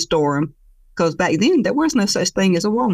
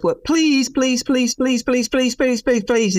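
pleading please, climbing higher and higher; a little brother begging, voice rising to a squeal; 7 semitones higher, slower than usual. Acted. Younger brother begging big brother to scratch his back, squealing higher. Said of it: "he gets higher and higher with his voice".

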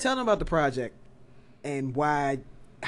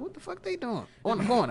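passerby voice; 9 semitones higher than usual. Retold or imitated. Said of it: "somebody looking in the car".

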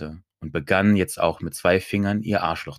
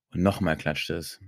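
ordinary delivery

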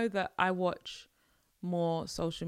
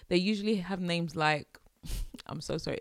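ordinary delivery

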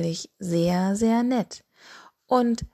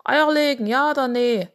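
higher, louder toad voice; pitched-up toad character voice; croaking toad; 5 semitones higher, 5 dB louder than usual. A narrator's reading. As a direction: croak it out like a toad demanding an answer. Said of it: "quakte die Kröte".

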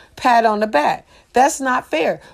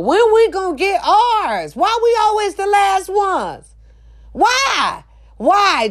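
anguished plea; 9 semitones higher than usual. Real, not acted. Pleading high questions.

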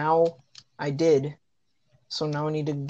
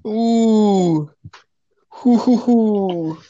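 loud hooting; 4 semitones higher, 9 dB louder, much slower than usual. Real, not acted. Loud mock reaction.

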